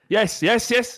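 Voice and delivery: high, keen call for the ball; eager yes call. Shown as a demonstration. Footballer calling for the ball, high and eager. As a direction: shout yes like you want the pass. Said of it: "so much better than my yes".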